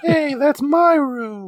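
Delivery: very high, drawn-out whine; slow, squeaky objection, like an embarrassed slob; high offended wail; whiny protest. Acted. Acting Skywarp protesting.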